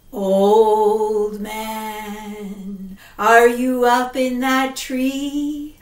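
low drawn-out ghostly call; eerie ghost calling; ghost woman. Acted. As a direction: call up the tree like a spirit.

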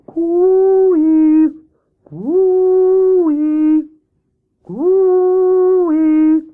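long, high, ringing coo-ee; calling out. Acted. Hare calls from the door for Grey Rabbit, calling out. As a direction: send the call far across the woods. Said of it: "he stood at the door and called".

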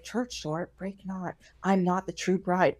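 very soft, low aside; hushed, private confession to the door; under her breath. Retold or imitated. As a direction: say it barely aloud, only to yourself. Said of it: "She's, like, saying this under".

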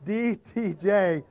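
D T J Design spelled out slowly, letter by letter; slow careful spelling. Real, not acted. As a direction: carefully force out each letter of D T J. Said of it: "the cold is getting to my mouth".

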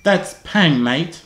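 slow demonstration in mock British accent; put-on British. Shown as a demonstration. Teaching a stranger British slang, put-on British accent. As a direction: model British slang slowly, dropping on "mate". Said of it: "I'll teach you".

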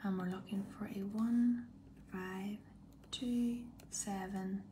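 slow hushed number reading; whisper. Real, not acted. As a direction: whisper each digit slowly. Suspense reading winning number.

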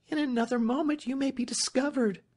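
put-on very high, anxious young lady; urgent warning. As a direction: warn him anxiously, pitch held high. Warning a hidden lover.

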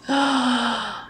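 sharp gasp of delight. Real, not acted. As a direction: gasp at how good it looks.

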